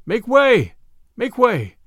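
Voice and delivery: loud, high urgent shout; crowd's refrain. A narrator's reading. Weary fleeing crowd calling ahead, loud high refrain. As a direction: shout it as a repeated warning cry. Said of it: "Through it all ran a refrain".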